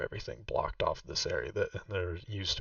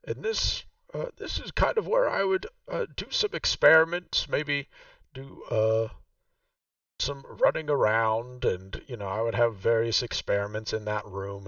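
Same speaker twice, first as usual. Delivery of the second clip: game master voicing Vaku, noticeably higher; Vaku's voice; 3 semitones higher, 5 dB louder than usual. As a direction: play the proud host showing her old home. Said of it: "she says".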